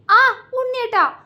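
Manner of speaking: sharp high yelp of pain on the name; ouch, bitten cheek, cry out his name. A narrator's reading. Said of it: "അവൾ വേദനയോടെ പറഞ്ഞു".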